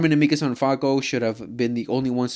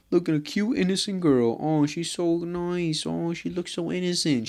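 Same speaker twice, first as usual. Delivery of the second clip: sarcastic sugary voice, pitched much higher; mock sweet; 7 semitones higher than usual. Vlogger mocking the anime girl everyone calls innocent, sarcastic sugary voice. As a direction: coo it like you're fooling everyone, dripping with irony.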